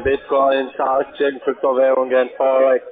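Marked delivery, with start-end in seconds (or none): none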